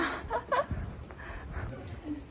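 breathy laugh. Real, not acted. Amused at 알짜배기.

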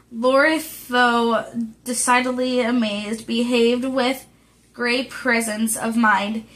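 plain reading voice

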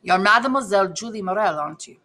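lightly voiced Italian accent; a touch of Italian in a stranger's question. A narrator's reading. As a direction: read it with a mild Italian accent. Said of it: "he said with a pronounced Italian accent".

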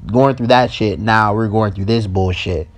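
normal talking voice

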